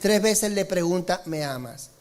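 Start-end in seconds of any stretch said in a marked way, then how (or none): 1.2-1.9 a gentle hurt question, barely voiced; soft pleading question. The Lord quietly asking Peter, soft and hushed. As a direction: ask it low and much softer, almost hushed.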